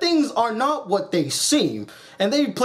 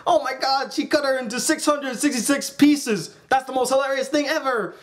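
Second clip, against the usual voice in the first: higher, changed villain voice; gleeful mayor voice; 4 semitones higher than usual. Retold or imitated. The mayor popping up to mock Brief, giddy higher voice. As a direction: gloat like a giddy little villain. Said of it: "pop up and say".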